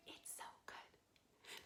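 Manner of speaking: soft whispered echo of the line. Shown as a demonstration. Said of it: "like an echo".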